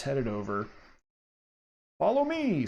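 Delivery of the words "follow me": high sinister cultist call. Acted. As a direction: beckon like a creepy cultist. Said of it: "says the cultist".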